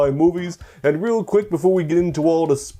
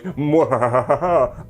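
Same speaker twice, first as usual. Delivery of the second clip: slow, drawn-out villain laugh; evil cackle; slower than usual. Retold or imitated. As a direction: cackle like a cartoon baddie. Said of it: "than just simply be like".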